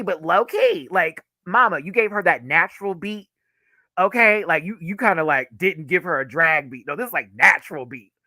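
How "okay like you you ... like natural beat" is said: normal talking voice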